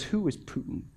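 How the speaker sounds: lightly voiced calm indifference; unfazed pastor. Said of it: "And he goes".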